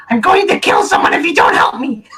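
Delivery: screamed high and loud in a rage; furious shout. Acted. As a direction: yell it at the whole group, furious. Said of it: "I'm gonna crawl back to the group and go".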